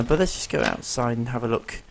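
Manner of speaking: normal talking voice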